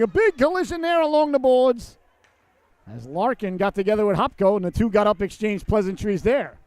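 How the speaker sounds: animated hockey commentary; excited play-by-play. Real, not acted. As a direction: burst high and loud on the collision, then ease. Live call of a collision.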